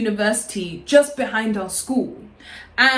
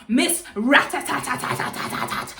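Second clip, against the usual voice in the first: mock rattling nickname; 2 semitones higher, 3 dB quieter than usual. Mocking the girl she spots at the window, mock rattling. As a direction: stutter the last syllable into a machine-gun rattle.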